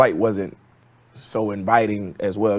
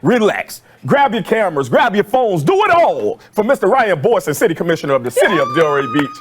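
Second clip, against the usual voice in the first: booming arena announcer intro, rising higher; Miami Heat announcer voice; 6 semitones higher than usual. Acted. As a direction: hype up the crowd like a stadium announcer. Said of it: "my Miami Heat announcer voice".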